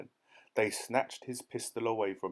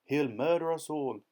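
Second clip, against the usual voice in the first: alarmed shout; 5 semitones higher than usual. A narrator's reading. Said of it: "shouted the Duke".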